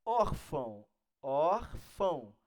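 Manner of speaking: órfão slowly, with a strong ÓR; stress on first syllable. Shown as a demonstration. Teacher showing the strong syllable, ÓR stressed in órfão. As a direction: draw out órfão, leaning on the ÓR. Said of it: "Sílaba forte tá aqui".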